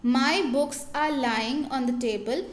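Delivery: very slow, word by word, clearly spaced; slow example reading. Shown as a demonstration. Teacher reading example sentences aloud to students, slow and deliberate. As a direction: read it out slowly for the students to follow. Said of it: "second sentence है".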